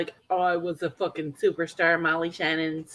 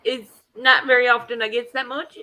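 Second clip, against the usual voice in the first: usual speaking voice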